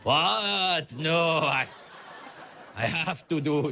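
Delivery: a lightly voiced Bond villain, slow and odd; Goldfinger impression. Comedian voicing Goldfinger opposing sanctions, lightly voiced villain. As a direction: mangle a villain's line, lightly. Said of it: "Did Goldfinger talk like that?".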